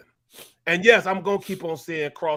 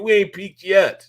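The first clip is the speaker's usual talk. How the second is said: slower, louder and firm; defiant outburst; 3 semitones higher, 9 dB louder, slower than usual. Real, not acted. Ex-player to his co-hosts, defiant and loud. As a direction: plant your feet and insist. Angry about fair-weather fans.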